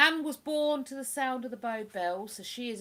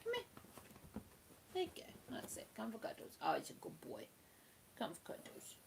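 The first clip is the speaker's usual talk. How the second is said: whispering to the dog; 14 dB quieter than usual. Real, not acted. Owner coaxing her dog into cuddles, hushed whisper. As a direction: whisper him over for cuddles.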